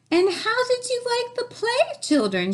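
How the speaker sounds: high teacher voice; Missus Beakley. A narrator's reading. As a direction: ask the class brightly as the teacher. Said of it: "she asked".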